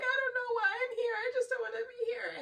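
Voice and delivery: high, sobbing, tearful voice; mock crying from a distressed psych patient; imitating a crying patient. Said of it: "one minute that they're crying and they're like".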